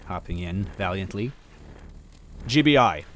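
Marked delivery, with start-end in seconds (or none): 2.5-3.0 even agent voice. A narrator's reading. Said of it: "Gino said evenly".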